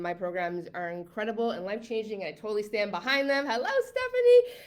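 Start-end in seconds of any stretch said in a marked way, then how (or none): 3.5-4.6 high, loud, delighted hello; excited greeting. Real, not acted. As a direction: call the name out high with joy. Greeting a viewer by name.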